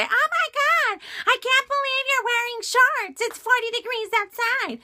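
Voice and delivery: high, far put-on nagging voice; mocking nagging coworker. Mocking the coworker about her shorts, in a high whiny voice.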